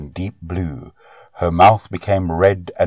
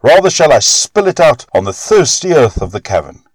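higher and louder, refusing him; defiant cry; 8 semitones higher, 5 dB louder than usual. A narrator's reading. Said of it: "she cried".

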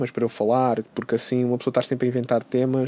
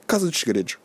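ordinary talk, nothing marked

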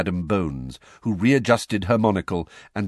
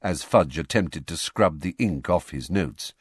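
ordinary delivery

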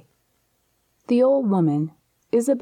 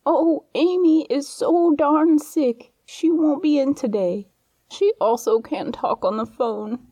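put-on older dramatic voice; 7 semitones higher than usual. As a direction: ham it up as a frail granny. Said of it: "switched tones to make herself sound older and more dramatic".

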